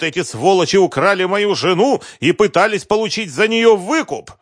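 high, loud frantic accusations; raging. Acted. Husband raging that his wife was killed, furious shout. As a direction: storm at him, climbing in fury. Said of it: "продолжал бушевать Концевич".

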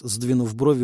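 plain reading voice

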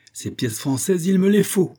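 imperious outburst; exclaimed demand. A narrator's reading. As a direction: rise to a high, loud insistence. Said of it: "s'écria Palmyrin Rosette".

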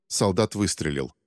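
plain reading voice

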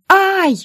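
high, loud cry of pain; pained yelp. A narrator's reading. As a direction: yelp as if struck in the eye. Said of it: "закричала от нервов".

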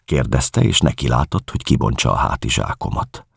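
ordinary delivery

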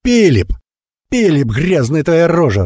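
high-pitched, scolding summons; angry calling out. A narrator's reading. Wheelwright shouting for the grimy boy, angry. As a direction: call the culprit sharply from a distance. Master angrily calls the boy.

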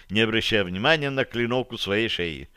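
usual speaking voice

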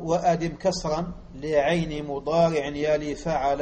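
flowing recitation of the line in the rhythm of the metre; recited verse. Shown as a demonstration. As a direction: recite it in one rhythmic sweep. Verse recited, then read slowly. (إلقاء البيت بإيقاع متصل)

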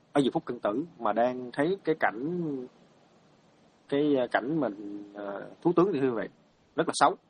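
ordinary talk, nothing marked

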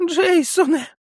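put-on high, slow, sobbing old man's wail; old man sobs over the boy's sandals. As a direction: weep the name at his feet. Old man kisses Jack's feet.

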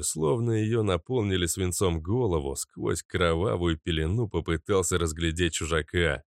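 ordinary delivery

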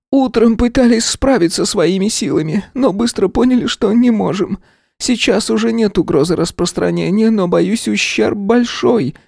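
high, strained woman's report; a distressed manager explaining; worried director. A narrator's reading. Director continues. (встревоженная директриса)

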